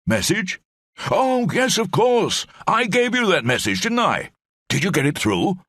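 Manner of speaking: put-on high, hearty old officer rambling on; blustering old brigadier. Old brigadier rambles to Lamb in the cell, high blustering voice.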